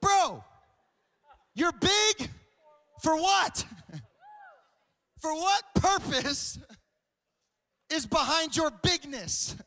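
high, loud, drawn-out shouting; outraged mock demand. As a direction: demand an answer in exasperated disbelief. Mock confrontation of big guys.

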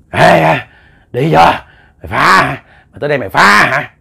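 a hint of a demon bullying the exorcist; snarling threat. Demon yelling at the arriving exorcist, mild snarling threat. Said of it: "là nạt nộ ông thầy".

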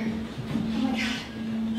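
breathless horrified whisper; terrified whisper. Acted. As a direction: gasp it out in mock terror.

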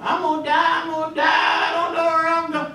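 high, wailing mock panic; panicking guy. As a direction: wail it like a man terrified on his deathbed. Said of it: "one of them panicking guys".

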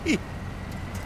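breathy high laugh; high giggle. Real, not acted. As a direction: laugh in surprise. Laughs at being winched.

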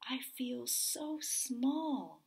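slow, whispered little voice; small whisper. Acted. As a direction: whisper it slowly, feeling tiny. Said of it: "she said to the whale".

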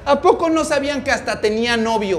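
high, loud acted tirade; spiteful boasting. Paola taunts Samantha's parents with secrets, spiteful boasting.

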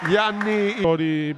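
ordinary delivery

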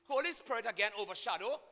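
usual speaking voice

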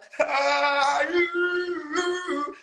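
high, drawn-out sung wail; mock gospel run. Mocking a man who knows gospels, high wailed run. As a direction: hold a high showy note, no words. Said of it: "because you know gospels".

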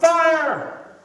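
very loud, high-pitched holler; yelled warning. Acted. Third prisoner tries his own distraction on the squad, yelled warning. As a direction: belt it out as a joke's punchline. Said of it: "and he yells out".

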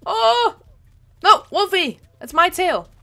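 loud, high, playful protest; mock outrage. Gamer yelling at a teammate who stole her tail, loud mock outrage. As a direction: yell at the thief as if truly wronged.

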